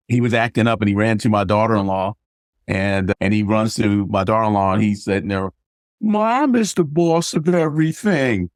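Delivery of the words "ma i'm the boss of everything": high, slow put-on child's boast; drawn-out, high-pitched mock bossiness; bossy little grandson. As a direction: imitate a kid declaring himself in charge. Grandson acting up, boasts to mother.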